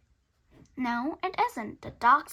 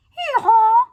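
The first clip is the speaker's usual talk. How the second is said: loud, high hee-haw bray; loud donkey bray; 11 semitones higher, 18 dB louder than usual. Acted. Animals chase dog.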